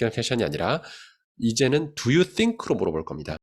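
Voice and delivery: ordinary delivery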